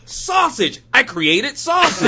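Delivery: high, loud, laughing showman proclamation; Stan Lee impression. Podcaster mimicking Stan Lee claiming he invented sausage, high and loud. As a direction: proclaim it like a proud huckster. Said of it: "Stan Lee would go".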